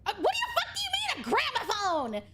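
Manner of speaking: squeaky high, fast mock disbelief; incredulous friend. Retelling her friend's reply to her speaker question, high and hurried. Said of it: "And he said".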